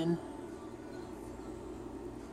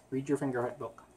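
usual speaking voice

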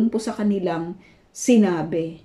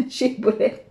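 ordinary talk, nothing marked

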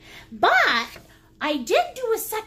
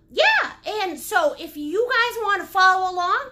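normal talking voice

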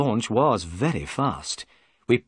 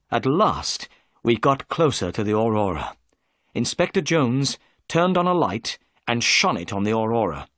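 ordinary delivery, nothing marked

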